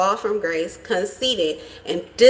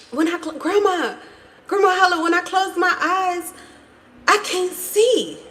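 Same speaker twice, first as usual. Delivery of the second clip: put-on little girl voice; 9 semitones higher than usual. Granddaughter to Grandma, high innocent child voice. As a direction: play the tricking granddaughter, higher. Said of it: "her granddaughter playing a trick".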